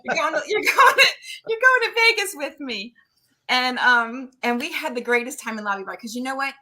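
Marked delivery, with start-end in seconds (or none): 0.0-2.9 high, loud eager pleading; excited coaxing to come to Vegas; coaxing a friend along. Retold or imitated. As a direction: talk Joe into the trip. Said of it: "I'm like".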